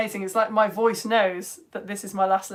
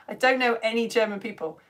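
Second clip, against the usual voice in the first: ordinary talk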